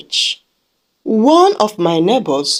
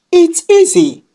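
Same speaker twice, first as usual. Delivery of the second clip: high mocking; 8 semitones higher, 4 dB louder than usual. A narrator's reading. Sorcerer reassures mockingly.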